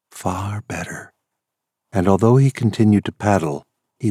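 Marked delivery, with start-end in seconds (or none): 0.1-1.1 soft murmured echo. Acted. Softer echo of her plea.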